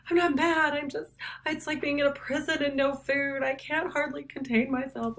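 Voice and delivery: a touch of heartbroken whining; lovesick sadness. Acted. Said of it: "Like, he's so sad".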